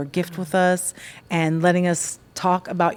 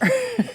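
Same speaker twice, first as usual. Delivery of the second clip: high laugh. Real, not acted. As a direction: burst of high delighted laughter. Laughing at old photo.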